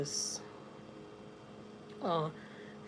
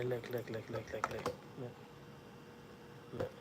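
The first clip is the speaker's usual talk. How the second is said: hushed; 10 semitones lower, 16 dB quieter than usual. Real, not acted. Hushed while dog nears purse.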